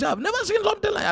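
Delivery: high, fast burst of amazement; retelling his own disbelief, quick and high; amazed. Retold or imitated. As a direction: rush it out, astonished. Amazed reaction retold.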